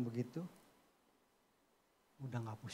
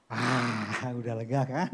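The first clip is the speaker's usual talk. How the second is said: usual speaking voice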